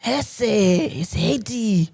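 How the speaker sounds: slow, lightly done Mexican accent; a touch of Mexican accent. Shown as a demonstration.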